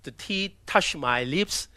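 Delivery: ordinary delivery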